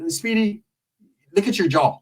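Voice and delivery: ordinary talk, nothing marked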